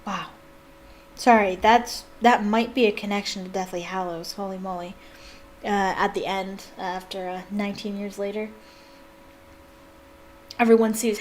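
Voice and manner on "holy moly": muttered aside. Real, not acted. Muttered under breath.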